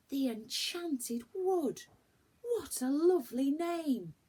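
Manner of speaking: high, loud, slow gasp of wonder; excited children. Acted. The children react to the wood's name with wonder. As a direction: savour the magic name.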